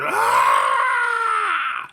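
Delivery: high, loud war cry; battle holler. Retold or imitated. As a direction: scream as you charge in. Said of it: "I went to holler".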